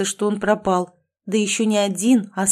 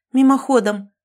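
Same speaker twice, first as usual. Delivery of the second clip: blurted out higher and louder; defiant blurt; 5 semitones higher, 6 dB louder than usual. A narrator's reading. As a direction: snap back boldly, pitch up. Heroine blurts a defiant answer.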